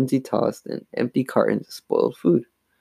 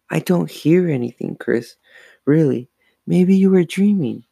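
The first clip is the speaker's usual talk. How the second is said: put-on girl voice; 6 semitones higher, 4 dB louder than usual. Twin voiced high.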